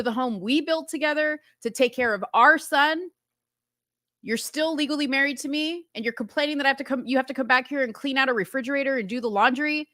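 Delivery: high-pitched, indignant acted-out tirade; frustrated husband listing grievances; really upset. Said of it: "really upset".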